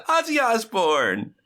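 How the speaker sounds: high, slow, beaming shout; big smiling shout. Retold or imitated. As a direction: beam it out with a grin. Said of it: "with a very big smile".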